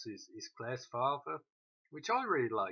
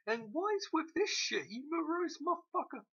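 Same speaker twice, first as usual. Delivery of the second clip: high, incredulous outburst; much higher mocking jab at the gloomy mother; exasperated rant; 14 semitones higher than usual. Real, not acted. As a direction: throw up your hands at the screen.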